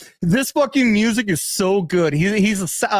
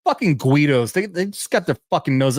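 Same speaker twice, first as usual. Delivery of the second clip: usual speaking voice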